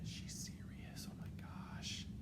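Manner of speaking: slow, hushed whisper; aghast whispered aside; thinking voice. Acted. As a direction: whisper it as a stunned inner thought. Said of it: "Let me use my thinking voice".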